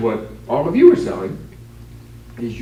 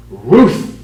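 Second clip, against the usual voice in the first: a loud, raised bark of "Ruth!"; dog barking the word; 5 semitones higher, 11 dB louder than usual. Acted. Talking dog names the baseball player with a bark.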